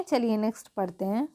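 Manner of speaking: ordinary talk, nothing marked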